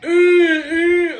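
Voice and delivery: loud, high drawn-out "eh", imitating a child; imitated kid's cry. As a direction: call out wordlessly like the excited kid. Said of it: "the kid's going".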